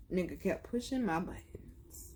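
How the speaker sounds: hushed aside, breath only; a dark whispered aside. Real, not acted. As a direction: whisper it like a secret grudge. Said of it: "unvoiced aside".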